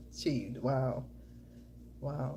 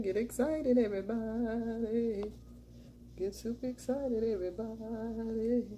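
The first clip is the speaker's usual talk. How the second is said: sing-song hype; 6 semitones higher, slower than usual. Real, not acted. Signature hype catchphrase to viewers.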